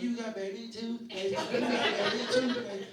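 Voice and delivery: high-pitched mocking child imitation; teasing little kids. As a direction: taunt like small children making fun of their uncle. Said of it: "start making fun of me".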